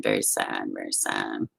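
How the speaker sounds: very sad trailing off to almost nothing; fading to near silence. Real, not acted. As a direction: let it fade out in sorrow.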